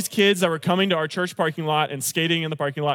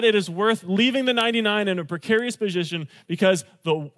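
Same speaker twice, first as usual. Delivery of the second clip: ordinary delivery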